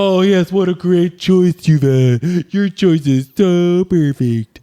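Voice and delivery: high, slow Muppet gushing. Pretend. Player praising the other's choice of quote, Muppet-like voice. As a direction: gush like a puppet, pitched high and drawn out. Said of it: "I sound like a Muppet".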